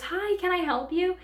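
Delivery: high, sing-song customer-service hello, lightly imitated; mock bright shop-assistant greeting; cheery counter greeting. Coworker turning to them at the counter, put-on cheerful greeting.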